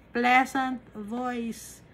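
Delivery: "Pleasant voice" stretched very slowly with stress on "Pleasant"; slow emphasis. Shown as a demonstration. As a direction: linger on "Pleasant" in "Pleasant voice". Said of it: "repeated key phrase slowly".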